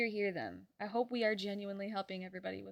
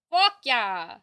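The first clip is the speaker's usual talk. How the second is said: "Fuck yeah!" shouted louder; loud cheer; 11 dB louder than usual. Shown as a demonstration. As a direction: yell it the second time.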